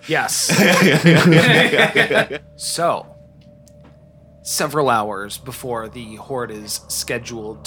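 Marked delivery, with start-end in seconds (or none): 0.5-2.4 laughter. Real, not acted. Laughing at egg carton.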